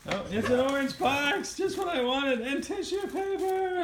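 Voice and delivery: high mock-thrilled squeal; faking delight at gift. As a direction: gush over the box like a delighted baby. Mother voicing baby's thrilled reaction.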